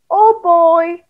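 ordinary delivery

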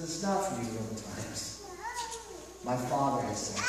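soft, low gentle correction; gentle rebuke. Retold or imitated. Jesus gently answering disciples, soft and low. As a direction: rebuke them ever so gently. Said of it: "rebukes so gently".

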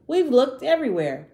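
a bold little dinosaur shouting that he isn't scared. Acted. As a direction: shout it out, refusing to believe. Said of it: "he shouted".

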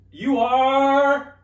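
loud, high, drawn-out announcement; suspenseful verdict. Pretend. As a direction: hold the words out like a game host. Game host draws out the verdict.

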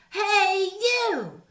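high, loud squeaky challenge; grouchy ladybug. A narrator's reading. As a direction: pick a fight in a tiny high voice. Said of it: "said the grouchy ladybug".

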